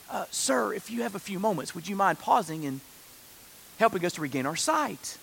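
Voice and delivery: mild, hesitant, overly courteous ask; politely trouble a stranger, keeping it soft; understated mock deference; mock-polite request. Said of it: "They don't say".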